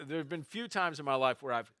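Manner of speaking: normal talking voice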